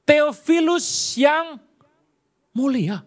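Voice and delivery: high, loud and slow; grand address. Shown as a demonstration. Preacher reads Luke's greeting to Theophilus: grand slow declamation.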